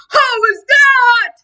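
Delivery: slow, high screaming boom. Acted. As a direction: scream it out like a monstrous baby. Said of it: "imagine this baby screaming like".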